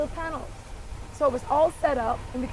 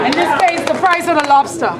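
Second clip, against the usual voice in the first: loud quip; 2 semitones higher, 12 dB louder than usual. Real, not acted. Quip shouted over the crowd.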